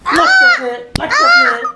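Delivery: squeaky high mocking echo of her words; mocking mimicry. Husband mimicking his wife's excuse in a shrill mocking voice. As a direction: parrot her back in a silly high voice.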